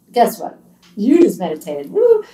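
loud, bright cheer rising to a whoop. Pretend. Cheering the listener who just meditated, loud whoop. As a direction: celebrate the listener's win with a big whoop.

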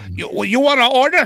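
high-pitched faked Chinese accent; mock Chinese accent. Restaurant answering another restaurant, high mock Chinese accent. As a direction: take an order in mock Chinese-accented English. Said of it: "Chinese restaurant calling the other".

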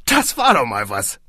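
impressed boss. A narrator's reading. Boss praises the blast of sound, impressed. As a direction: savour it.

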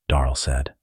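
plain reading voice

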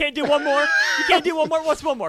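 high loud teasing taunt; mock taunt. Cohost joining the cookie's taunt, high and loud. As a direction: egg him on like the cookie.

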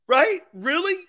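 high, loud incredulous squeal; mock disbelief. Scoffing at people crying over a contract, high incredulous squeal.

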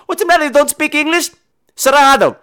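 high, loud, brusque refusal; caricatured high-pitched shout; mock gym owner. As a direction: imitate her turning a customer away, high and loud. Imitates gym owner turning him away.

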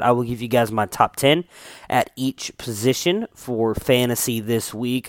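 ordinary talk

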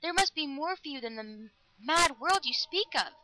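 high, impassioned plea; crying out. A narrator's reading. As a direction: keep insisting, pitch held high. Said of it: "Galatea cried".

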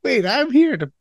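high-voiced mock protest; a little feminine. Customer thinking back at the mocking bartender, high protesting voice. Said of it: "because I'm a little bit feminine".

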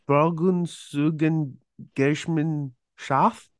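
Frauenzugengesellschaft dragged out very slowly in a mock German sound; mangled German word. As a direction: butcher Frauenzugengesellschaft slowly and on purpose, like a wild guess. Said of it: "when I terrorize the German one".

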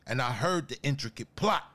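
ordinary delivery

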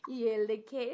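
ordinary talk

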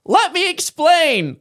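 high, slow, desperate plea; panicked plea. Retold or imitated. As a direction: beg her to hear you out. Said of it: "And he goes".

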